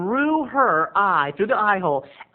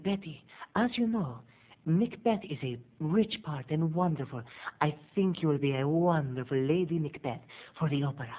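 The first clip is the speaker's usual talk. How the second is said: softer, hammed-up glamorous diva agent; over-the-top glamour agent; 7 dB quieter than usual. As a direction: purr it like a fur-coated diva. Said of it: "her agent is over the top glamour".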